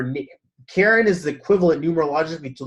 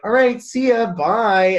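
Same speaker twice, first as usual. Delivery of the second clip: higher, louder chirpy see ya; breezy goodbye; 7 semitones higher, 6 dB louder, slower than usual. Retold or imitated. Leaving a stunned cashier, chirpy louder goodbye. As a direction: toss off a bright cheery farewell.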